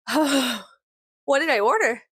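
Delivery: half-laughing what did I do; groggy alarm. Retold or imitated. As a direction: act out waking to a surprise order. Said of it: "I'm like".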